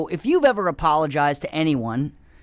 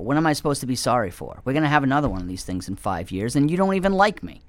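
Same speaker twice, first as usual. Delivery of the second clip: ordinary delivery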